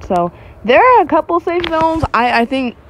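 normal talking voice

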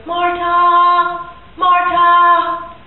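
high, loud singsong call, name drawn out; mother calling. Acted. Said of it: "your mother calling your voice".

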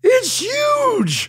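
very high, squealed amazement; imitated thrilled shriek. Fiancée's first words on seeing the ring, high-pitched squeal. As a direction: squeal it like a mock-stunned bride.

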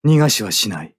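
ordinary talk

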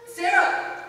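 calling out. Acted. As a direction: call her name desperately. Ghost calling to his wife.

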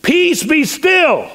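high, drawn-out command; acted, ringing order to the sea; commanding the storm. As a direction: call slowly over wind and waves. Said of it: "That's the man who said".

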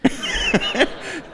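high, breathy mock child's giggle; toddler's giggle. Toddler giggling as he falls toward his dad. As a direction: imitate a delighted little kid giggling in midair. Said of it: "the kid was over there going".

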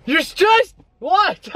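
high, gasped disbelief; playful mock shock; gasping surprise. Real, not acted. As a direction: act shocked at the news. Mock shocked repeat of sister.

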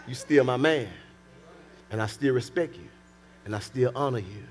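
low, gentle acted pledge of respect; soft reassurance. Wife telling her husband she still honors him, soft reassurance.

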